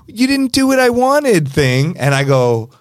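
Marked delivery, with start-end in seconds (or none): 0.1-1.5 high, pouty mock whine; pouty complaint. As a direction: whine it like a spoiled guest. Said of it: "the shrug and the open eyebrows up".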